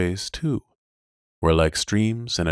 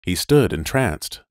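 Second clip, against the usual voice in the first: ordinary delivery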